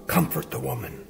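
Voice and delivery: soft hushed urgency; a hushed command from heaven; whispered. A narrator's reading. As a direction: hurry him along under the breath. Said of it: "God in heaven whispered".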